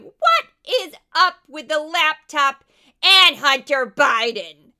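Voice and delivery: very loud, slow, over-dramatic TV narrator; Judge Pirro. Retold or imitated. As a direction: belt it out as a hammed-up documentary narrator. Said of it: "imagine how hilarious this is".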